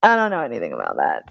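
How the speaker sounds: low, soft, quick dumb mumble; playing dumb. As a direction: sink the voice low and small. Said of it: "play dumb and be like".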